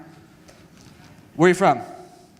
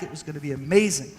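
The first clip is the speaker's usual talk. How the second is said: ordinary talk, nothing marked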